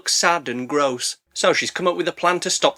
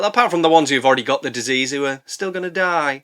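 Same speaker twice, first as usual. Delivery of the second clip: usual speaking voice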